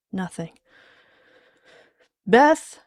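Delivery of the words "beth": loud call with rising pitch; raised, searching call; louder call. Acted. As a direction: call out loudly, lifting it into a question. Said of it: "a bit louder".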